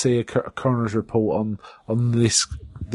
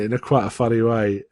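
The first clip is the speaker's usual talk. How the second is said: usual speaking voice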